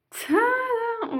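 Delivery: high, bright tadam fanfare; grand reveal. Real, not acted. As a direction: announce it like a magic trick. (annonce triomphale)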